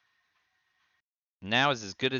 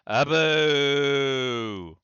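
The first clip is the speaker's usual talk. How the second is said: loud, high outburst; loud exclamation; 6 semitones higher, 10 dB louder than usual. Real, not acted. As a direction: blurt it out loud. Exclamation at an opponent's play.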